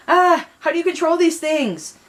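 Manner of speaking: high, loud yelp while flying out of control; panicked scream. Acted. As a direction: yell in flailing panic. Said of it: "He was screaming".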